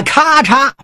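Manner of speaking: high sharp crack of a blade; chop sound. A narrator's reading. Storyteller voices the sword hacking the table. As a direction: snap out the chop.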